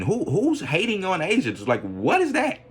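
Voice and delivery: high-pitched baffled questioning; mock incredulity.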